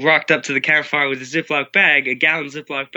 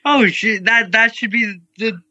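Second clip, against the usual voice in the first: alarmed realization; 7 semitones higher than usual. Retold or imitated. Teen retelling his alarm at the potluck cookie. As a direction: hit it like sudden alarm.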